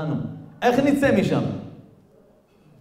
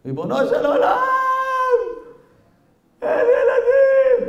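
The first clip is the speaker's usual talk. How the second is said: loud, high, drawn-out cry; screaming to God; 4 dB louder than usual. Acted. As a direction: act out a desperate wail to heaven. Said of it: "והתחיל לצעוק בתוך הבית כנסת".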